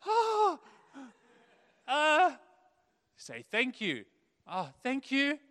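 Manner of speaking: put-on high, loud little kid voice; squeaky child realizing the answer. Child remembering to say thank you, high.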